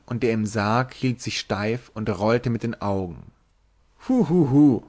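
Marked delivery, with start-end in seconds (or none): 4.1-4.8 a higher, louder giggling hu hu hu; tipsy sailor's giggle. Acted. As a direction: giggle at the whole company. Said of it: "kicherte Tarpaulin".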